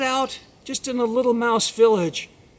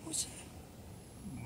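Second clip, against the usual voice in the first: very soft, slow hushed question; startled whisper; 21 dB quieter, much slower than usual. Acted. Mouse hearing an unknown voice, startled whisper. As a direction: whisper it, wary of being heard.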